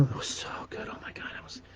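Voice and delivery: breathless whisper of delight. Real, not acted. Remembering his mother's warm oatmeal, whispered. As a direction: whisper it as if tasting the memory. Savouring memory of mom's oatmeal.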